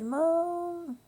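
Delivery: put-on a frightened girl shouting for her mother down the hall; child yelling for mom. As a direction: call out to mom like a scared child again. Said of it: "I yelled at the top of my lungs".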